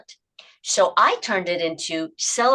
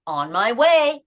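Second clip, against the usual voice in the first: bright upbeat call to yourself; cheerful self-praise; 5 semitones higher, 6 dB louder, much slower than usual. Shown as a demonstration. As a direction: say it like a small win. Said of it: "reward yourself by saying it out loud".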